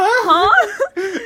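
high, loud, laughing huh; baffled squeak through a laugh; surprised little yelp; confused. Retold or imitated. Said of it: "You were like".

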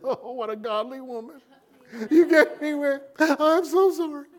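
high, tearful mock sobbing plea; hammed-up weeping repentance, pitched high; slobbering apology. Pastor weeping to God over his wife, mock sobbing. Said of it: "he was on his face slobbering".

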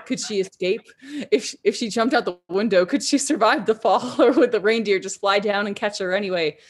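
plain reading voice

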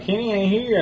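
high-pitched imitation of a friend; retold denial. Man telling visitor his friend isn't there, high mimicked denial. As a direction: mimic his denial well above your own pitch.

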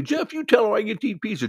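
high, loud ranting demand; going off on her. Retold or imitated. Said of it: "starts going off on her again".

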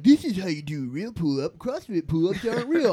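loud dumb meathead gym bro; loud lazy jock. Retold or imitated. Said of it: "That's a meathead voice".

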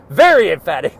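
loud playful self-parody; emphatic. Shown as a demonstration. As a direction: belt out "Very emphatic" with mock gusto. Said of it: "that was very emphatic".

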